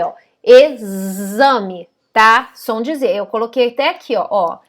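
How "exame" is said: "exame" drawn out slowly, x voiced as z. Shown as a demonstration. Teacher stretching "exame" to show its Z. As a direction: linger on the z in "exame". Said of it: "o som do Z".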